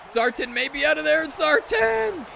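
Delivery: rising to a high, loud cry; excited commentary. Real, not acted. Streamer calling Zartan out of there, climbing excited shout.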